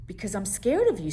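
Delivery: blurting it out in fear; scared little monster. Acted. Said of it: "said Monster".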